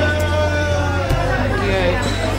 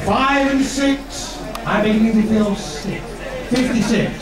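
sing-song number calling with repeats; bingo caller; 4 dB quieter than usual. Acted. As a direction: call each number, then repeat it falling.

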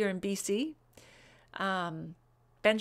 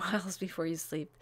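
ordinary talk

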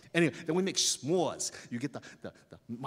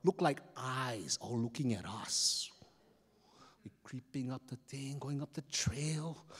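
slow hushed whisper; spooky whisper; 4 semitones lower, 5 dB quieter, slower than usual. Retold or imitated. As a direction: whisper it like a ghost story. Creeping up trail at night.

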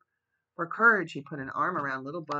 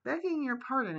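put-on much higher, polite old woman's voice; old lady interrupting; 10 semitones higher than usual. Old lady interrupts the arguing crowd, higher. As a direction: cut in primly like an old lady. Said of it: "interrupted a very old lady".

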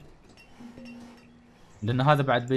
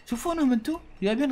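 much higher, half-shouted disbelief; shrill disbelief. Real, not acted. Gamer telling viewers to look at his villagers, in disbelief. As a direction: throw your hands up in disbelief.

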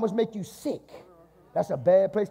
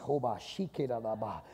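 tongues; 12 semitones lower, 12 dB quieter than usual. Real, not acted. Preacher breaks into tongues.

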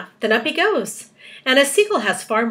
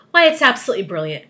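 quick, loud, raised exclamation; delighted cry; 5 semitones higher, 8 dB louder, much faster than usual. A narrator's reading. Grasshopper hearing James's plan, crying out in admiration. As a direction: exclaim at a brilliant idea. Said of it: "cried Old Green Grasshopper".

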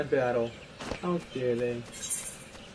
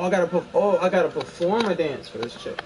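ordinary delivery

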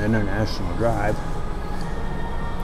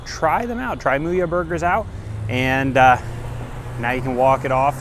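higher, upbeat host wrap-up; vlog outro; 7 semitones higher than usual. Acted. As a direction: sell it to the viewers.

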